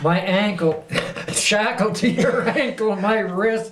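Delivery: high, loud, laughing delivery. Real, not acted. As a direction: crack up while picturing it. Laughing over imagined shackles in court.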